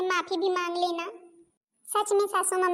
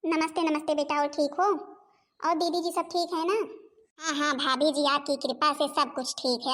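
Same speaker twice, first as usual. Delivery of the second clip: normal talking voice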